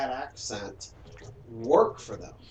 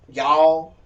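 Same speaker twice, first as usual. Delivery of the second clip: mock Southern drawl on "Y'all"; 12 dB louder than usual. Shown as a demonstration. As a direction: stretch "Y'all" like a caricatured Southerner. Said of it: "L's and R's".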